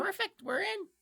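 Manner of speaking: high, chirpy imp voice; squeaky imp, satisfied; pleased imp voice. Acted.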